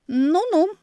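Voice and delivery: high, loud sceptical "Ну-ну". Acted. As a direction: doubt him out loud. Marya sceptical.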